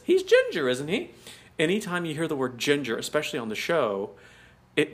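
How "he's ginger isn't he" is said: very high imitated question, rising at the end; Miss Brahms. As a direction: mimic her, squeaking up on ginger. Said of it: "when she says".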